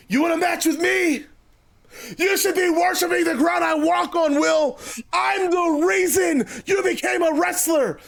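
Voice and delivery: high-pitched haughty wrestler promo; Jericho, self-important. Acted. Star wrestler claims credit for rival's career, high and haughty. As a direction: demand worship, pitched high and indignant.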